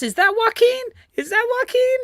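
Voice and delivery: high, delighted question; surprised. Real, not acted. Spotting a viewer in the chat.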